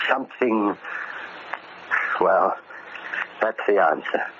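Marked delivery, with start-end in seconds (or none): none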